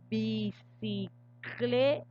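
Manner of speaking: "bicicleta" said slowly, syllable by syllable, with "cle" pushed out; stress on cle. Shown as a demonstration. Teacher modelling a word for the class, slow with "cle" stressed. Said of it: "Hago la mayor fuerza de voz aquí en cle".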